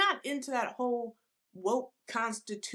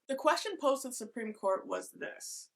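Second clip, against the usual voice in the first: usual speaking voice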